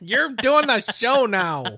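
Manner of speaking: slow, loud, high playful reprimand; mock stern. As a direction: spell it out like a fake strict boss. Scolding Anna on air.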